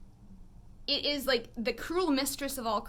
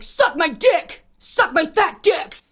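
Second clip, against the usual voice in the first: slow, loud, high-pitched heckling; imitated shouting; 8 semitones higher, 13 dB louder, much slower than usual. Man on a bike circling back to harass them, imitated shouting. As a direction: holler each word like a street harasser. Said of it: "he starts just shouting at us".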